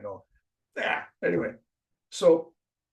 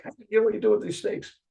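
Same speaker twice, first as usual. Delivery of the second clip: aggressive; 11 semitones higher, 6 dB louder, faster than usual. Retold or imitated. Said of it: "like, aggressive".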